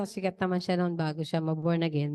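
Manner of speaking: ordinary delivery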